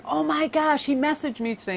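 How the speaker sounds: squealing high, a thrilled fan; imitating excitement. Retelling a viewer's joy at a message, high and excited. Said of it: "she was so excited".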